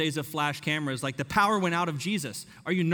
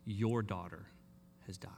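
whispered news; 7 semitones lower, 8 dB quieter than usual. Shown as a demonstration. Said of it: "someone whispers in Jairus' ears".

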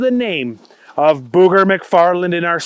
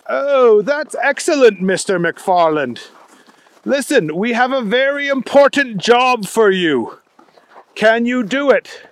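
pleased British caller, slightly raised pitch; weird British accent; 4 semitones higher than usual. Acted. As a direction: offer the job in a put-on British accent. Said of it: "a pretty weird accent".

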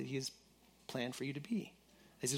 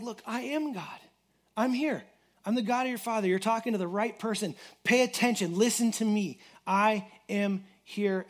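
a lightly voiced God, higher and louder, insistent; preacher voicing God calling Jacob to pay attention; God speaking; 5 semitones higher, 6 dB louder than usual. Preacher voices God to Jacob, a mildly raised, insistent call. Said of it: "And so God's like".

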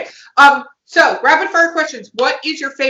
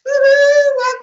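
high, loud whoop; excited cheer; 12 semitones higher, 5 dB louder than usual. Real, not acted. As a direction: whoop to kick off the show. Host cheering as she opens show.

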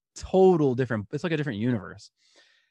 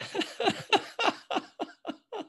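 embarrassed laugh. Retold or imitated. Caught naked, reacts with laugh.

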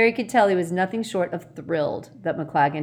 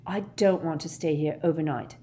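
ordinary delivery, nothing marked

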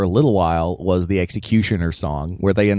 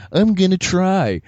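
high, slow, trying-hard voice; a hint of hopeful middle schooler; mock eager kid; 9 semitones higher, 3 dB louder than usual. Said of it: "where I was just like".

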